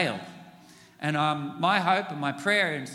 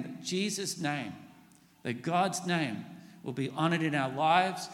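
normal talking voice

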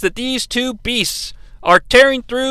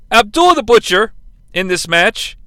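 ordinary delivery

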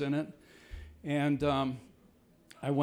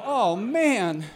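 laughing groan; 7 semitones higher, 6 dB louder than usual. Retold or imitated. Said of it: "I go".

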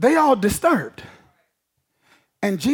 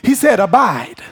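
"abide" hit firmly and louder, falling; stress on abide; 4 dB louder than usual. Shown as a demonstration. Preacher driving home "abide" over "attach". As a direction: punch "abide" against "attach". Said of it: "He didn't just say attach".

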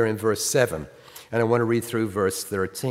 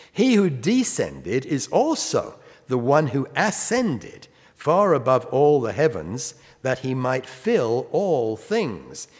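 slow, higher, louder Bible reading; scripture reading; 4 semitones higher, 4 dB louder, slower than usual. Acted. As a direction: lift each phrase, slowly and deliberately. Reads scripture aloud.

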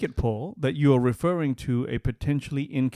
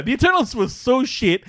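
usual speaking voice

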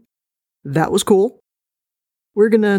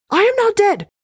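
squeaky high cry; 14 semitones higher than usual. Acted. Host reacts as the cat.

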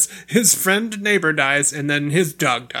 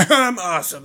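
cocky Uncle Red; 4 semitones higher, 3 dB louder than usual. Retold or imitated. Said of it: "and Red was like".

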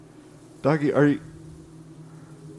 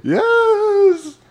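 high silly voice; 5 dB louder, much slower than usual. Acted. NPC voice.

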